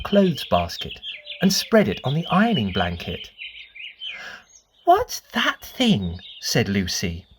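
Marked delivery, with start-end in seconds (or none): 4.8-6.2 put-on higher, slow, puzzled little girl; lightly voiced little girl. A narrator's reading. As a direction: ask slowly like a small curious girl. Lucy voiced in story.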